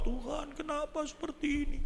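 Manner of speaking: high, soft pleading voice; acted lament to God. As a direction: plead upward in a small high voice. (ratapan tinggi dan pelan, menirukan)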